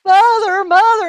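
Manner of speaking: loud, high yell for help; boy hollering with a bleeding hand; hollering for mother. A narrator's reading. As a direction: scream for mother from across the house. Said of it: "he's hollering".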